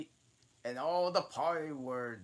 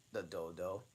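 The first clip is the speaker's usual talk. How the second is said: ordinary delivery, nothing marked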